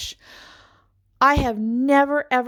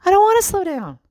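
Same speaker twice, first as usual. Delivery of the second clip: higher and louder, insisting; louder insistence; 9 semitones higher, 4 dB louder, faster than usual. Retold or imitated. Mom insisting to her family, louder and high. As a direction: push it harder the second time.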